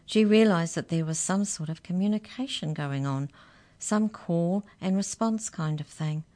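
ordinary delivery, nothing marked

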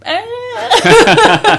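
high, loud scared "Ah"; frightened dogs' cry. Acted. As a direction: cry out like a frightened little dog. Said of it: "That's the one".

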